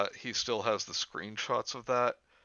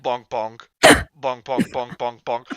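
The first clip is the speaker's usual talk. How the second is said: bonk sound effects; 11 dB louder, slower than usual. Acted. Sound effect for game hits.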